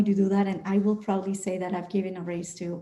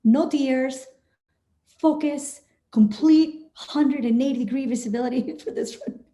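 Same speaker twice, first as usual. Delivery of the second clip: raised, punchy self-command breaking into a laugh; mock pilot focus; 4 semitones higher than usual. Pilot ordering self to focus for landing, mock stern. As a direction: drill yourself to concentrate, half-joking.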